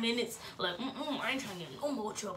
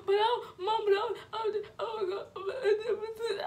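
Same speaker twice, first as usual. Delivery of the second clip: high, laughing, crying stammer; stuttering; 10 semitones higher, slower than usual. Retold or imitated. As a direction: stammer and sob it out. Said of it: "I was stuttering".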